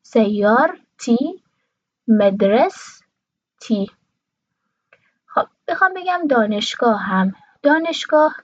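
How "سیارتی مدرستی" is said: سیارتی and مدرستی slowly as sayyar-ti, madras-ti; slow split demo of سیارتی and مدرستی, no vowel before ti; two-part common pronunciation. Shown as a demonstration. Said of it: "عموم کشورهای عربی دو سیلابی تلفظ".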